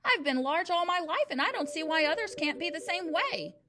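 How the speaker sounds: bullying headmistress. A narrator's reading. Trunchbull lectures the boy in a loud, overbearing voice. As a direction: boom it down at the class.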